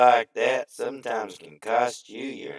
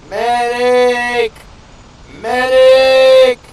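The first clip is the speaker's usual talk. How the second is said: high loud cry for a medic; man down shout; 13 semitones higher, 15 dB louder, much slower than usual. Pretend. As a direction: scream for the medic. Calling for a medic.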